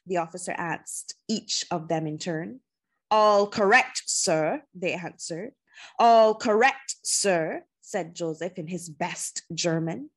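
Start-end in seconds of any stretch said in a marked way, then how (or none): 3.1-4.6 loud, crisp parade-ground reply; soldiers' answer. A narrator's reading. Soldiers answering the officer at parade, loud and crisp. 6.0-7.6 loud, crisp military answer; disguised soldier's reply. A narrator's reading. Joseph reporting to the officer in disguise, loud and military.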